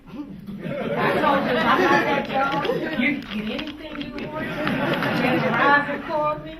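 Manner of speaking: preaching-style proclamation at a funeral; loud and slow. Real, not acted. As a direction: proclaim it to the crowd.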